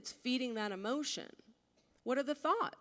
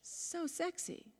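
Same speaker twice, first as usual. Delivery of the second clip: dreamy swoon; 4 semitones lower, 7 dB quieter than usual. Retold or imitated. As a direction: melt over his voice. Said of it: "So I just go".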